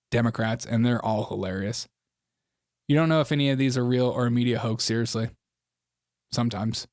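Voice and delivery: ordinary delivery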